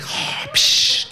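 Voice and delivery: mimicked mechanical breathing; Darth Vader. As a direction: breathe in and out like a masked villain. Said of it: "can we talk like that".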